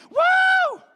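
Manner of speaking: very high, loud cheering whoop; piercing, hammed-up party yell; excited whoop. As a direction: whoop like a rowdy crowd member. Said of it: "I'm surprised you weren't like".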